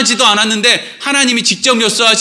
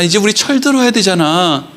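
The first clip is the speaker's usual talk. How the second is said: ordinary talk, nothing marked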